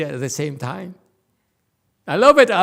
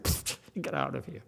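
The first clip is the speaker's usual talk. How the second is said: muttered scornful pfft, much softer; dismissive brush-off; 12 dB quieter than usual. Retold or imitated. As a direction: wave it away under the breath. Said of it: "I said".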